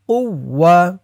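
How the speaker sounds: ordinary talk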